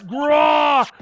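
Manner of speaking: loud, high acted roar; tiger roar. Voices the charging tiger.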